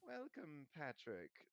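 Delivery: mildly stiff, formal welcome. A narrator's reading. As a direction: greet him politely but coolly. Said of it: "said Nick stiffly".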